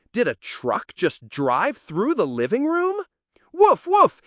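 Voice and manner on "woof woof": loud high yapping woofs; barking dog. Acted. The dog next door barking in alarm, loud and high. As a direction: bark in alarm like a startled dog.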